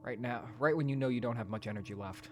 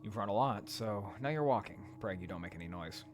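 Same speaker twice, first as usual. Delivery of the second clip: usual speaking voice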